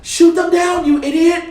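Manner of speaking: very high, loud mock yell; sarcastic yell. Princess orders the pilot to fire, sarcastic high yell. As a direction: yell the order as a hammed-up exasperated shriek. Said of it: "sarcastically or whatever".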